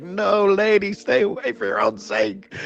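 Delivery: high-pitched mock urgent warning; retold plea, high and amused; porter warning someone off. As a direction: imitate a porter holding someone back. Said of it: "the hotel porter has to pretty much, like, tackle her".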